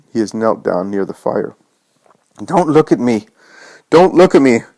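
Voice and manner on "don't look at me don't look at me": high, rising panicked cries; frightened shout. A narrator's reading. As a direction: cry out as if desperate to flee. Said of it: "shouts the man".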